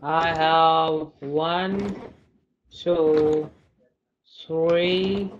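gleeful slow count of the loot; excited counting. Real, not acted. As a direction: count each one up gleefully.

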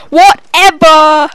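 stretched, loud mock scream of whatever. Mocking the teammate on the mic with a screamed whatever. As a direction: ham up the teammate's whiny scream. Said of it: "the latest scream from our Matty Doody friend".

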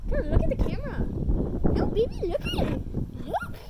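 high, loud coaxing call. Real, not acted. Coaxing the baby to face the camera, high loud baby talk. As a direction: coo urgently so she looks up.

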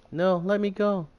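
frightened pleading. Acted. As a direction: plead to be let go, a bit scared. Girl resists being taken away.

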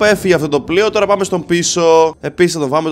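ordinary delivery

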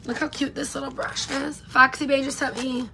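usual speaking voice

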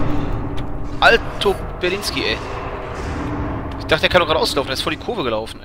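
loud, high yell at the game; excited shout. Real, not acted. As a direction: yell out at the screen. Yelling at a game enemy.

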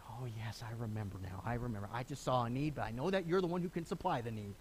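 soft realization. Pretend. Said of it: "it's like Mary had to say".

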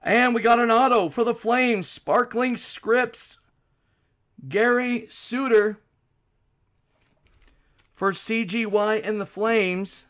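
loud, high, punchy card call; card break announcer. Real, not acted. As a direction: announce the pull with big energy. Calls out cards live.

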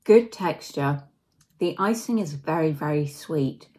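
speaking between chews; chewing while talking. Real, not acted. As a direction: mouth partly full of cake. Seen 1: Bit the cake at -3s; mouth still working while she talks.

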